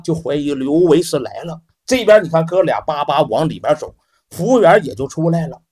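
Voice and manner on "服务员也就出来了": plain reading voice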